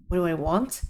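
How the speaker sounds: ordinary delivery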